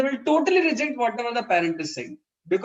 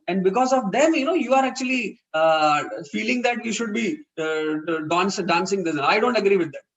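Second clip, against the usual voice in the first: impatient father; 3 dB louder than usual. Retold or imitated. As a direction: lose patience and tell her off. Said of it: "Suddenly the father lost his patience".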